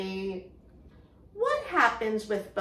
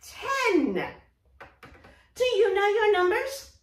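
bunny puppet; 7 semitones higher than usual. Acted. Teacher voicing Bunny puppet.

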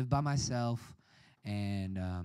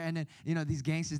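ordinary delivery

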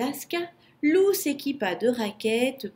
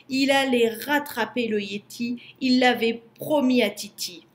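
ordinary delivery, nothing marked

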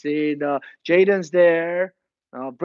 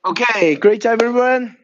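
higher and louder, calling across the room; loud class greeting; 5 semitones higher, 6 dB louder than usual. Real, not acted. New instructor greets the class with a loud call. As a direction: boom it out to the whole class.

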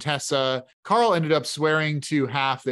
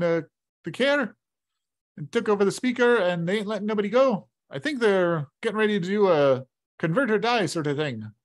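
raised, folksy barkeep with a grim edge; chuckling innkeeper delivering bad news; innkeeper voice, higher; 5 semitones higher than usual. Innkeeper warns the boys nobody leaves town, folksy and grim. Rourke explains the knights.

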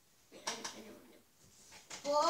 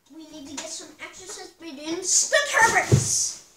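loud; 3 semitones higher, 15 dB louder than usual. Real, not acted. Loud announcement.

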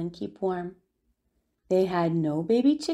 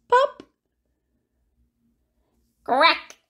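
sound effect pop; 7 semitones higher, 6 dB louder than usual. Acted. Shell bursts open.

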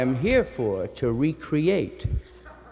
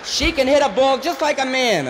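high, loud gossipy neighbor; proud chatty townswoman; gossiping neighbor; 10 semitones higher, 5 dB louder than usual. Retold or imitated. Neighbor gossip voiced in routine.

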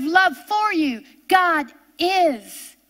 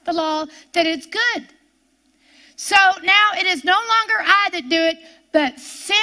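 scripture reading; 3 semitones higher than usual. Acted.

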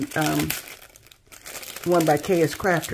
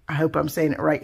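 ordinary talk

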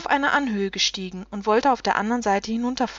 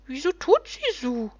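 gloomy rumphob; 4 semitones higher than usual. A narrator's reading. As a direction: grumble it gloomily as a high-voiced little creature. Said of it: "sagte düster".

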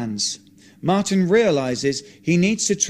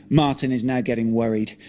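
usual speaking voice

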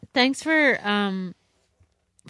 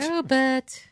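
higher, lightly put-on British voice; mock Mary Poppins; 6 semitones higher than usual. Echoing co-host's impression, higher mock British voice.

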